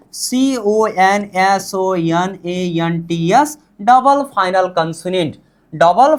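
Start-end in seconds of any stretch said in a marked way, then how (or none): 0.1-3.6 slow, lower letter-by-letter spelling. Shown as a demonstration. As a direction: spell it slowly, one letter at a time. Teacher spells out a term.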